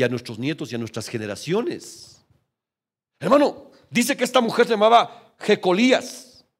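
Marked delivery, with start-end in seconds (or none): 3.2-6.4 loud, high call to the congregation; preaching burst. Real, not acted. Pastor rousing his congregation, loud and high. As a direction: lift the voice to rouse the room.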